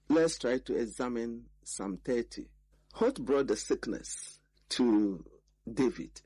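ordinary talk, nothing marked

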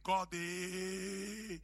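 put-on exclaimed in a thick African accent, drawn out. Startled preacher answering God, African accent. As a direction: let the African accent out on a long cry. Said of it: "my African accent came out".

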